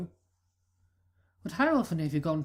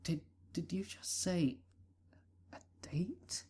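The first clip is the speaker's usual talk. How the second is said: stammered whisper, fading softer; nervous whisper; 6 dB quieter than usual. Acted. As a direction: whisper so no one overhears. Checks no pony listening.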